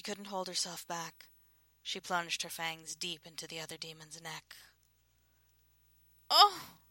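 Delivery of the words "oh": high, loud cry of joy and pain; startled high-pitched gasp of pleasure; sharp exclamation. A narrator's reading. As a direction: cry out suddenly, much higher and louder. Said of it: "Vivienne exclaimed".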